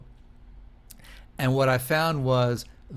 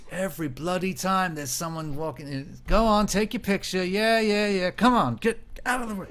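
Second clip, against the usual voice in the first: higher, mocking cab driver grumble; a fed-up London cabbie shooing tourists; fed-up English cabbie; 7 semitones higher than usual. Cab driver grumbling at tourists, mock fed-up voice. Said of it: "he's like".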